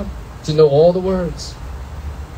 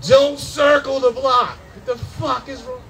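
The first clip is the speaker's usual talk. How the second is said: mock warning yell; 5 semitones higher than usual. Warning about circling the block.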